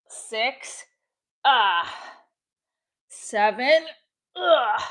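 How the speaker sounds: counting reps with effortful grunts; straining grunts. Real, not acted. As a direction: push out each number, then grunt. Counting push-ups with effort grunts.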